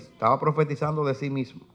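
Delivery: ordinary talk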